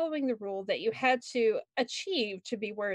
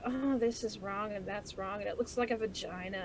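self-critical mutter; 7 dB quieter than usual. Retold or imitated. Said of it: "I look at my raccoons and I go".

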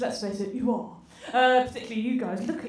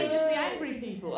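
ordinary talk

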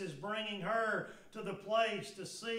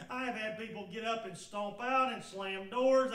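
ordinary talk

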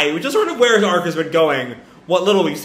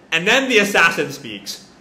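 usual speaking voice

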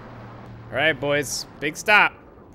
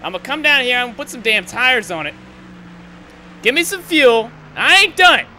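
higher and louder, barked at the crew; angry orders; 8 semitones higher, 7 dB louder than usual. Real, not acted. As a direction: demand it, still fuming. Yelling orders to pit crew.

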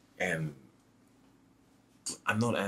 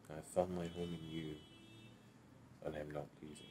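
soft sincere promise; hushed, tender vow; 14 dB quieter than usual. Acted.

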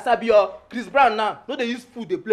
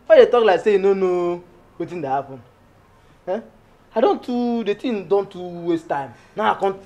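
ordinary delivery

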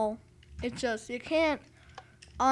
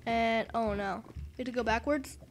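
normal talking voice

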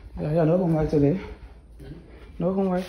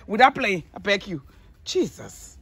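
ordinary delivery

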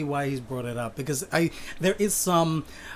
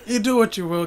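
laughing; 12 dB louder than usual. Real, not acted. Laughs at guest's joke.

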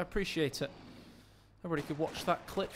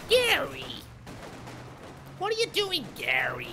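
high, loud Canadian housewife; 13 semitones higher, 3 dB louder than usual. Pretend. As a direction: shriek it like a Canadian mom. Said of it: "with a Canadian housewife voice".

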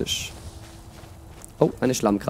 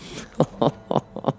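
short chuckle in separate bursts; a real, wordless little laugh; 4 semitones higher than usual. Real, not acted. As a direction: chuckle to yourself. Laughs after the story ends.

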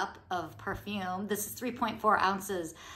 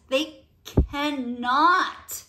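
they cannot stretched slow and louder; drawn-out emphasis; 5 semitones higher, 6 dB louder, much slower than usual. Real, not acted. Vlogger insisting the brand must not discontinue it. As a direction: lean hard on cannot.

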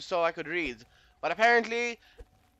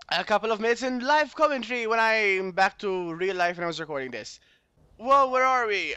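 usual speaking voice

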